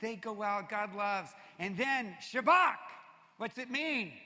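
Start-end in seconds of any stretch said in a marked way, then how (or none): 1.6-2.8 Shabach said slow and loud, rising at the end; Shabach drawn out. Shown as a demonstration. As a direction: lift the word Shabach and hold it. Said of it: "names the Hebrew word".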